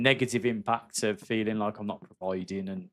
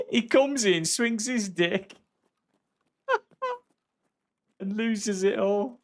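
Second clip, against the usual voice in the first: laughing; 11 semitones higher, 4 dB louder, slower than usual. Real, not acted. Laughing at a chat message.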